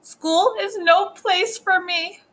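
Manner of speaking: high, slow whine; miserable, drawn-out high voice; tearful child. A narrator's reading. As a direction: wail it like an upset little girl. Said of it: "I think she's crying".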